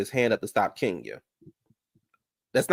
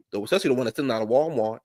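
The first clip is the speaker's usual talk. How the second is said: normal talking voice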